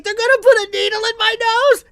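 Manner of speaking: put-on very high, loud whiny kid; crying kid voice. Nephew hears about the needle, high crying kid voice. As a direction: wail it like a scared boy. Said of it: "So now he starts crying".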